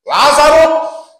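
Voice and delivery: long, drawn-out loud call of a name; commanding call. Acted. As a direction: summon the dead man out, slow and loud. Jesus calls dead Lazarus. (chamado longo e forte, com autoridade)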